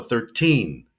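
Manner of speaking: put-on "thirteen" with a long, drawn-out TEEN; stress on TEEN. Shown as a demonstration. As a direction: stretch and stress the second syllable of "thirteen". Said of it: "we wanna stress the teen".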